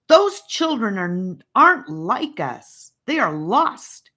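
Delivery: firm, loud and deliberate; loud interruption. A narrator's reading. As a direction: cut her off with weight. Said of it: "Rivers interrupted her loudly".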